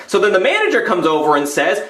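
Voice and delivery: usual speaking voice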